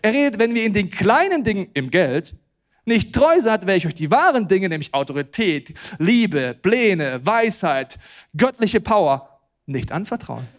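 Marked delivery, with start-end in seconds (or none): none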